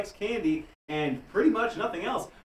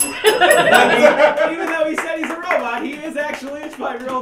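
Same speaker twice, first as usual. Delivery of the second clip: laughing; 11 semitones higher, 11 dB louder than usual. Real, not acted. Host cracking up.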